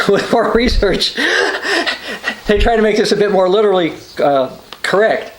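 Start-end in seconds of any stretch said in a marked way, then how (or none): 0.1-3.9 amused, higher-pitched chuckle through the words; laughing while talking. Real, not acted. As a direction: laugh at your own joke mid-sentence. Laughing at his own joke.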